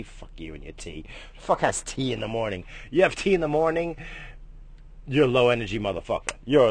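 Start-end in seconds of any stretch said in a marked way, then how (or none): none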